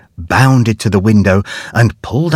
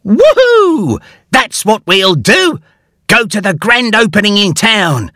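very high, whooping with glee; thrilled little bear shouting his idea; excited cry; 10 semitones higher than usual. A narrator's reading. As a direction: burst out with a new plan. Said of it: "cried Huxley excitedly".

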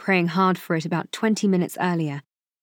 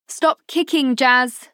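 raised, higher-pitched mother's call; 6 semitones higher, 5 dB louder, slower than usual. A narrator's reading. Mother to the son drumming heels on her chest, calling up. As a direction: call up sharply to a child overhead. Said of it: "she called up".

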